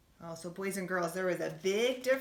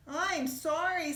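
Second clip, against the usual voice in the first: high, drawn-out apology; sorry plea; 10 semitones higher, 5 dB louder, slower than usual. Acted. Little bunny apologizing to his mother, high and drawn out.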